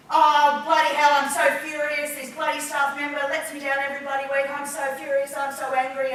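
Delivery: loud, hammed-up furious venting; really angry. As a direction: play an irate boss ranting at high volume. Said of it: "pretend I'm acting really, really, really angry".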